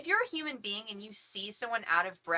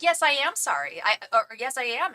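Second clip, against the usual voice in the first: loud, higher, biting sarcasm; sarcastic loud apology; 4 semitones higher, 8 dB louder than usual. Retold or imitated. Imagined retort to the rude instructor, loud and sarcastic. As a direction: spit the fake apology back at her.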